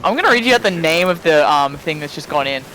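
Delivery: ordinary talk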